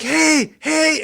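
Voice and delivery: high-pitched acted call; yelling to someone. Game master voicing the player nearing the worker, yelling. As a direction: call it out high without full volume. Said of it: "I'm still yelling, obviously".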